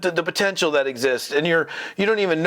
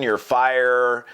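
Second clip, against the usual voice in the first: ordinary talk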